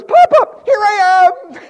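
put-on very high, loud little boy voice; toddler reveal. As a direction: shout like a proud little kid. Grandson reveals himself.

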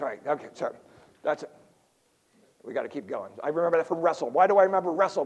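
ordinary talk, nothing marked

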